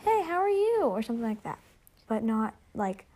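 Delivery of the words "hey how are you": high-pitched, sing-out greeting; chirpy text greeting. Acted. Host acting out a quick text to a friend, chirpy. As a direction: act out a breezy hello, pitched up.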